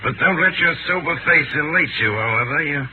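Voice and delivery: ordinary talk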